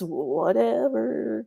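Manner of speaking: long drawn-out, lazy "whatever"; carefree whatever. Acted. Describing a father nothing bothers, drawn out and carefree. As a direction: stretch it right out, not caring. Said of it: "just kind of one of those ... kinda people".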